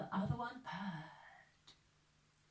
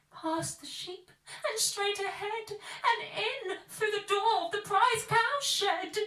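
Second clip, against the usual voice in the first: much higher and louder tiny ladybird voice; little ladybird warning the animals, high and urgent; 9 semitones higher, 7 dB louder than usual. A narrator's reading. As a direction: squeak out the warning to the farmyard. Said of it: "told the other animals".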